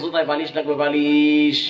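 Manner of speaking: talking in sleep. Retold or imitated. Husband muttering in his sleep to his wife, drowsy. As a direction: mumble it like a dreaming man. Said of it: "ঘুমের ভিতরে জামাই কইতে আছে".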